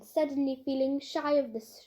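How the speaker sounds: plain reading voice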